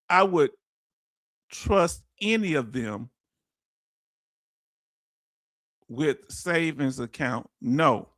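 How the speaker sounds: reading the comment out slowly and low; drawn-out, deliberate reading of a viewer's words. Retold or imitated. Host slowly reads Farm Girl's comment to the stream, deliberate.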